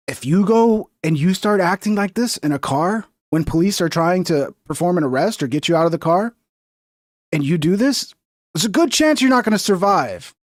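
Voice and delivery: ordinary talk, nothing marked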